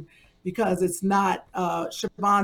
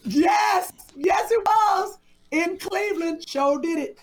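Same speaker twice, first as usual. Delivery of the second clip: excited yes; 8 semitones higher, slower than usual. Real, not acted. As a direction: shout it out gleefully. Excited confirmation.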